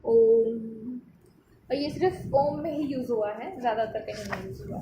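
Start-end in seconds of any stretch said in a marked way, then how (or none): 0.0-1.0 ओम held long and a bit louder; drawn-out om. Shown as a demonstration. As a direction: chant ओम with a long vowel. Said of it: "प्लुत का निशान".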